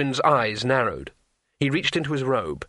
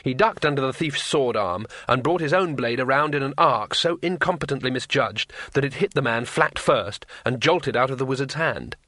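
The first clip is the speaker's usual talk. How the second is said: ordinary delivery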